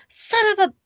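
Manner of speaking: 아주 높게 치솟는 자책의 외침; mad at myself. Retold or imitated. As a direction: squeal it out in furious self-blame. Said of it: "I was, like, so mad at myself".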